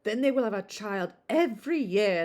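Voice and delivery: lightly voiced matron stressing every; gossipy matron. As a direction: chatter it as the busybody. First take, in character.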